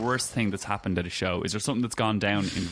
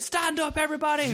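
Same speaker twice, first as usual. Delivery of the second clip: much higher, hammed-up rock star hyping the crowd. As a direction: mimic a cocky frontman working the arena, pitched way up. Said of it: "he's like".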